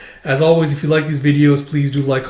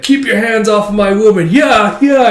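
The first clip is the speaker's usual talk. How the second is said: child mimicking tough guy; 6 semitones higher, 5 dB louder than usual. Anya quotes movie line.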